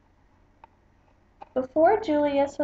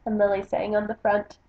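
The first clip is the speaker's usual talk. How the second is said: plain reading voice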